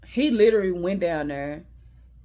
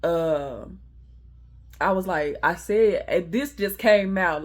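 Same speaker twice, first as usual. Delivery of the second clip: ordinary talk, nothing marked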